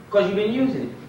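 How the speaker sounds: ordinary delivery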